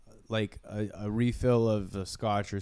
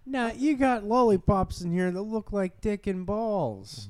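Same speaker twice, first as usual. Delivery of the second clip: high-pitched amazed TV host; Huell Howser; 11 semitones higher than usual. Acted. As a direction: play a wonderstruck presenter, voice way up. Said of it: "like a place Heel Hauser would go".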